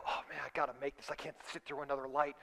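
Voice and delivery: low, soft, hurried acted mutter; muttering to himself. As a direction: mutter impatiently under the breath. Acted muttering to self while driving.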